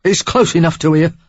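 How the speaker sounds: loud Cockney man insisting; a wounded Cockney rough, pushing through pain; gruff Cockney. A narrator's reading. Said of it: "with a Cockney twang".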